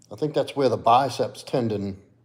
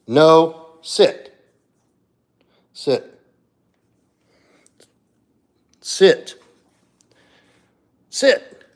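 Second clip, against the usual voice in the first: louder, higher commands, building with each repeat; firm dog command; 6 semitones higher, 8 dB louder, much slower than usual. Real, not acted. Owner ordering Fancy to sit, louder each time. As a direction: insist until the dog obeys.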